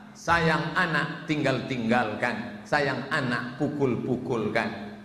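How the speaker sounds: slow, low proverb recital. Acted. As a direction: intone the old saying gravely. Recites Malay proverb slowly.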